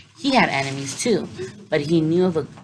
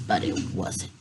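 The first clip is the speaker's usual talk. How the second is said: breathy near-whisper, much lower and softer; disappointed whisper; hushed letdown; 9 semitones lower, 7 dB quieter than usual. A narrator's reading. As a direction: let the line sink away to a whisper. Disappointed turn in the story.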